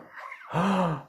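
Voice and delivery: sharp high gasp of horror; a high startled intake of breath; horrified gasp. Acted. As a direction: gasp in shock. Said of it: "Pumbaa gasped in horror".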